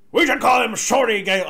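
mock upper-management voice; put-on executive voice. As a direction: send up a bigwig amused by his own joke. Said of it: "doing that particular voice".

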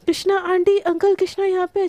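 high, loud call from the rooftop; urgent cry for help; shouting for help. Acted. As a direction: yell for the parents to come. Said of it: "छत पे एकदम चिल्लाने लगती है".

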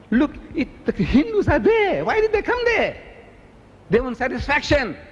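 high-pitched, exclaimed objection; impassioned protest. Retold or imitated. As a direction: cry out in heated protest. Re-enacting his protest at the meeting.

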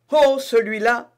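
high, loud confident outburst; eager exclamation. Acted. D'Artagnan to Constance, dismissing the obstacle, eager. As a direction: brush the obstacle aside with gusto. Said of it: "s'écria D'Artagnan".

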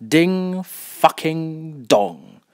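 slow, sardonic chime; drawn-out doorbell mimicry. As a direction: spell out the chime word by word. Sarcastic imitation of the doorbell.

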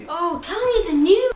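usual speaking voice

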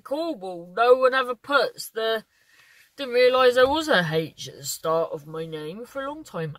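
usual speaking voice

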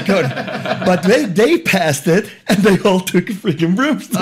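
laughing through the words, high; can barely get it out for laughing. Real, not acted. Telling a friend about the broomsticks, laughing.

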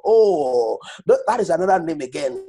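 ordinary talk

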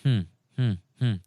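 put-on old official grumbling hm hm hm; gruff humming grunts. A narrator's reading. Old official grumbling under his breath, soft hums. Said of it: "ร้องอู้".

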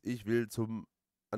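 ordinary delivery

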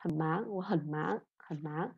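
softly repeated "khẩn máng", "khẩn" low without rising; half third tone. Shown as a demonstration. Teacher drilling the students, "khẩn" as a half third tone. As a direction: keep "khẩn" down, then lift "máng". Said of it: "mình không cần phải xuống rồi lên hết".

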